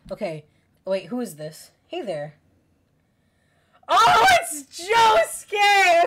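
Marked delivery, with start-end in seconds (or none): 3.9-6.0 high, loud, drawn-out squeal of recognition; excited shout. Real, not acted. Fan spotting Josuke in the game, excited shout. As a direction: shout it slowly with delighted surprise. Streamer spots a favourite character.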